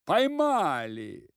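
loud, high, slow shout; triumphant cry. A narrator's reading. Constables bursting in, loud triumphant cry. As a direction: call out the catch proudly. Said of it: "вскрикнули вошедшие".

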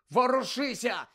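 high, loud impatient command; shouting. A narrator's reading. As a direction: yell it to get something moving. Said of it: "закричав на нього Гаррі". (нетерплячий крик)

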